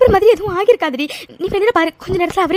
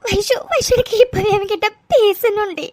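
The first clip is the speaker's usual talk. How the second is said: slow, sobbing plea; tearful pleading; slower than usual. Acted. Mithu begs Vaishu to let her talk to him, tearful pleading. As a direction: break up the words with sobs. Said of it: "please Mithu, அழாதடி".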